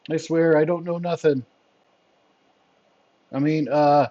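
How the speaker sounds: lightly voiced sheepish captive protesting ignorance; sheepish henchman. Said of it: "He's looking sheepishly".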